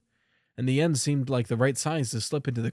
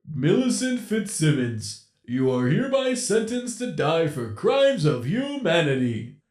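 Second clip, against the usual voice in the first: higher, slow, judge-like animatronic voice; solemn mock sentencing; 4 semitones higher, 4 dB louder, slower than usual. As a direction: pronounce the sentence like a grim judge. Captor pronounces a death sentence.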